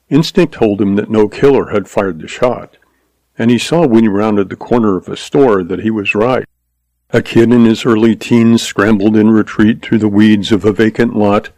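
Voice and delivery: ordinary delivery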